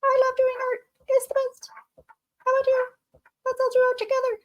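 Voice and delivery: high sped-up chipmunk voice; squeaky cartoon chipmunk chatter. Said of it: "make me talk like a chipmunk".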